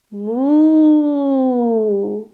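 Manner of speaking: long, slow mooing like a cow. Shown as a demonstration. Teacher mooing for the kids, drawn-out cow moo.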